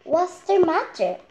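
high, loud worried hen voice; hen asking. Acted. Henny Penny asking the chick, high and worried. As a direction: ask the panicking chick what's wrong. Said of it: "asked Cookie Lookie".

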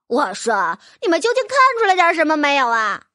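loud, fast, exasperated outburst; impatient. Acted. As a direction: snap it out, fed up with waiting. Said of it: "不耐烦了". (不耐烦地大声催促)

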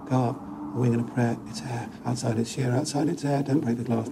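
plain reading voice

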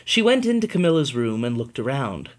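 plain reading voice

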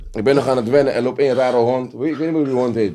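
ordinary talk, nothing marked

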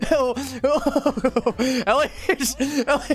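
name squeezed out through laughter; laughing call. Real, not acted. Player calling Ellis during chase, laughing.

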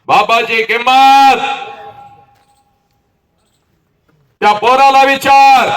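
loud, high, drawn-out cry; impassioned oration. Acted. Speaker challenging students on a father's worth, high loud cry. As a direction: hurl it high at the crowd, stretching the words.

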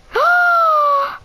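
sharp, high, loud delighted gasp. Real, not acted. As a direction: gasp out in triumph. Finally tames the dog.

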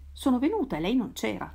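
plain reading voice

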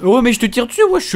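loud high battle yell; excited shout. Real, not acted. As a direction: shout at the enemy mid-fight. Shouting while firing at an enemy.

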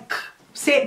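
whispered bare "k" burst; voiceless K with no vowel; exaggerated final K. Shown as a demonstration. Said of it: "Eu vou dar uma exagerada nesses finais". (K sussurrado, sem vogal)

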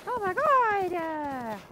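slow, high, drawn-out groan; exasperated wail. Real, not acted. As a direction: stretch it out in despair.

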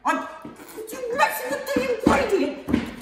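hammed-up outrage, pitch shooting up; mock furious yell. Chef yelling at his assistant that he is the cook, hammed-up shout.

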